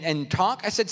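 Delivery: ordinary talk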